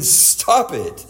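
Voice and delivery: ordinary talk, nothing marked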